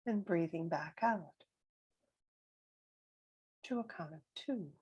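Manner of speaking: calm meditation voice, long silences between phrases; guided meditation. Real, not acted. Guide counting breaths with listeners, calm and spacious meditation voice. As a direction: leave room for each breath, gently.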